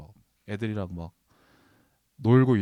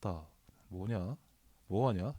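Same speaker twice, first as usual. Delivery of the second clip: low, slow, soft Jeolla drawl; Jeolla dialect imitation; 3 semitones lower, 10 dB quieter, slower than usual. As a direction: imitate Jeolla friends' unimpressed dialect, quietly. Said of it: "제가 전라도 사투리 따라하면 전라도 친구들이". (낮고 느리게 전라도 사투리 흉내)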